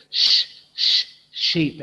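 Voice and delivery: "Sh, sh" hissed unvoiced, then "sheep"; teacher models the sh sound for the class; sound then word. Shown as a demonstration. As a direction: stretch the sh before "sheep". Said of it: "Very good".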